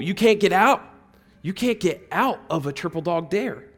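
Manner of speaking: normal talking voice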